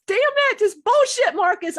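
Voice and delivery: high, loud retold outburst; reenacted fed-up shouting; mock scream. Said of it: "I wanted to scream at him".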